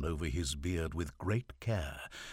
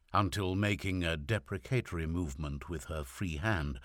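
usual speaking voice

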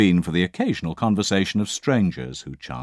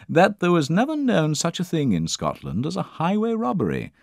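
higher, self-important gentleman; pompous English traveller lecturing the inn, higher voice; 9 semitones higher, 5 dB louder than usual. Acted. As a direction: hold forth like a pompous traveller. Pompous traveller praising Scots.

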